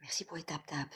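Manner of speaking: hushed whispered thanks; whispering. Real, not acted. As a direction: whisper so the house stays quiet. Whispered replies to chat.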